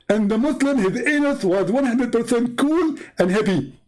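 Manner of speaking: a smug preacher figure explaining his proof; mock Zakir Naik. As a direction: lecture the caller with mock confidence. Preacher figure describing the experiment.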